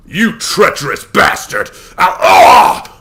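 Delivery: slow, loud, then a pained cry; furious shout. A narrator's reading. Mamaragon rages at treacherous Warren, furious yell. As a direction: rage then break off in pain.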